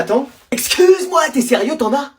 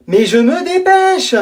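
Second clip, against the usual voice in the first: slow mock protest; 7 semitones higher, much slower than usual. Friend claims to be hurrying.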